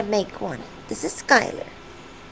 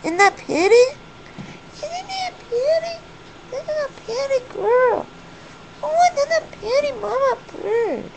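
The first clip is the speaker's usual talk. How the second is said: high cooing admiration; doting pet talk; 12 semitones higher than usual. Real, not acted. Owner admiring her pretty mama bird, doting pet talk.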